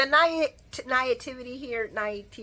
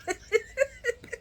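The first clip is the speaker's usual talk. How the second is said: a burst of high laughter; 14 semitones higher, 6 dB quieter than usual. Real, not acted. Laughing at Sonny's line, high burst of laughter. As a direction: laugh at your own joke.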